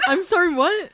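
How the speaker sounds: stunned i'm sorry what; disbelieving. Real, not acted. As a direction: repeat it in mock outrage. Echoing disbelief at the confession.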